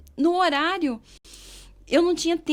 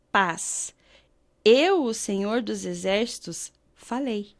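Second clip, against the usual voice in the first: lower, very slow, weighty reading of the verse; slow, low and reverent, each phrase given weight; solemn scripture reading; 4 semitones lower, much slower than usual. Acted. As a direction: read it like a proclamation from God. Reads Bible verse aloud.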